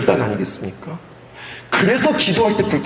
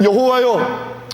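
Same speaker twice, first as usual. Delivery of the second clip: weeping prayer; 12 semitones higher than usual. Acted. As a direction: cry out to God like a dying king begging. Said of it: "심히 통곡하는데 말이 뭐냐면".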